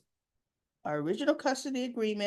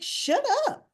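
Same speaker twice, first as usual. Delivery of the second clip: high, louder snippy shut up; mock shut up; 5 dB louder than usual. Ava silently telling Rick to shut up, mock snippy. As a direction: toss it off like a mock brush-off. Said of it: "she's looking at him like".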